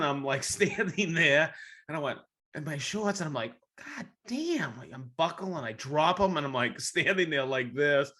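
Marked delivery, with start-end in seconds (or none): 3.8-4.8 high strained curse. Retold or imitated. Standing in shorts looking down.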